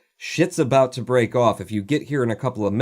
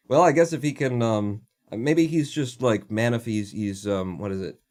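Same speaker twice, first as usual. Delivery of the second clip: usual speaking voice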